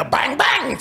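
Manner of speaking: high, playful mock gunshots; bang bang sound effect. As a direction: pop off two gunshots. Gun sound effect in tequila joke.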